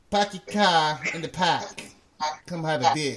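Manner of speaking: drawn-out, hammed-up Boston accent; mock Boston accent. Host teasing Boston people, mock Boston accent. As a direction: ham up the Boston vowels, unhurried. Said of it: "That sounded like a Boston accent".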